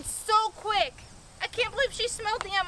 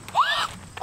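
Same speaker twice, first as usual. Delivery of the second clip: gasp. Real, not acted. Sees llama in tree.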